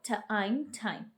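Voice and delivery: ठ, आइन, ठाइन sounded apart, then joined; teacher sounding out ठाइन; sounding out with magic e. Shown as a demonstration. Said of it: "थिम को".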